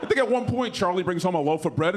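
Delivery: ordinary talk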